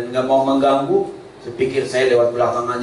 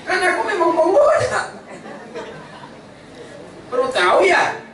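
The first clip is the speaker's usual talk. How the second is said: high, shouted smug boast with laughter; Satan gleefully admitting he is the tempter; cheeky Satan; 13 semitones higher than usual. Pretend. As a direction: play the devil proudly owning it. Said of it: "Setan ngomong apa?".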